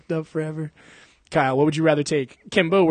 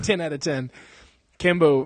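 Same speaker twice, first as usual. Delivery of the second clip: ordinary talk, nothing marked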